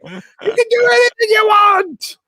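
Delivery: mocking a president's brag about beating champions, in a high hammed-up shout; mock Trump-style boast. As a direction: ham it up like a brash boasting politician.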